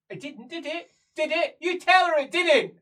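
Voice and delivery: high, loud, mounting demand; insistent. Acted. Prisoner demanding he back up her version, insistent and loud. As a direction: press harder with each question.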